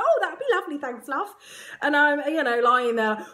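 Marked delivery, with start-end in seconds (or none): 0.0-1.3 lightly voiced friendly paramedic; a hint of a pleased paramedic accepting tea. Paramedic accepting tea from mum, lightly played pleased. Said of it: "And he's like".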